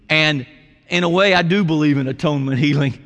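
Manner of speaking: normal talking voice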